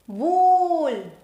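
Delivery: Wool with the oo held long. Shown as a demonstration. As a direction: draw out Wool. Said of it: "Sound is again long".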